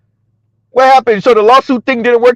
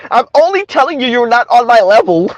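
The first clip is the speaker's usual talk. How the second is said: mock condescension in a raised voice; Kim Foster; 4 semitones higher than usual. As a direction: imitate a superior woman, pitched up.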